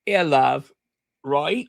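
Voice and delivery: mock English accent; bad English accent. Host teasing herself to viewers, bad English accent. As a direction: slip back into a badly faked English accent. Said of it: "I do the worst English accent".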